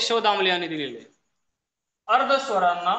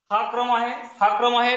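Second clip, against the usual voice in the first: ordinary delivery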